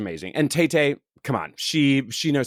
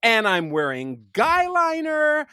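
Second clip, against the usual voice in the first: hammed-up boast; 9 semitones higher, slower than usual. Continuing sassy boast.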